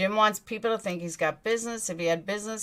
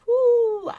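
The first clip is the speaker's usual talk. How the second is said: loud high woo; excited woo; 8 dB louder than usual. Real, not acted. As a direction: whoop it out. Excited about sent message.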